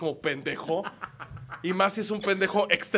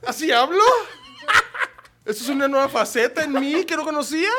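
high, loud indignant mimicry; hammed-up offended reply; high exaggerated retort; 11 semitones higher, 10 dB louder than usual. Comedian retells his offended reply, high and loud. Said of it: "Y yo".

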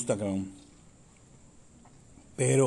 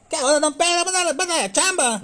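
much higher, louder mock Yucatecan comic voice; mock exaggerated accent; 6 dB louder than usual. Mocking a fake-accent comic asking a boss for work. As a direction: ham up the fake accent going to ask for a job. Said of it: "¿A poco así vas a pedir chamba?".

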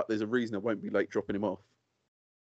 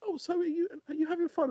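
chatty stranger. Retold or imitated. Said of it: "and they're like".

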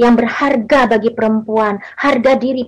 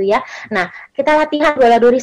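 usual speaking voice

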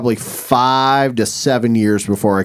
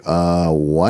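mock bafflement; 8 semitones lower than usual. Students react to teacher's lewd story.

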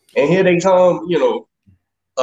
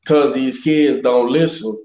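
ordinary talk

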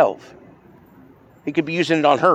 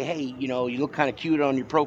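lightly imitated flirty text, softer and lower; feminine energy; 3 semitones lower, 6 dB quieter than usual. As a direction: softly put on a coy feminine energy. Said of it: "his energy is in the feminine range".

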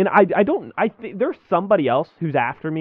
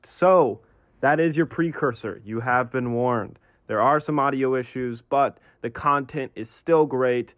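announcer read; slower than usual. Acted. Recorded intro disclaimer read.

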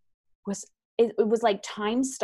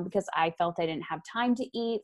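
usual speaking voice

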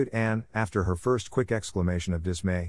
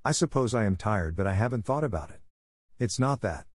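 usual speaking voice

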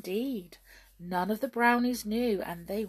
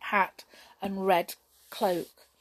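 ordinary delivery, nothing marked

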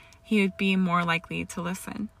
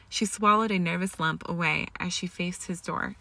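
plain reading voice

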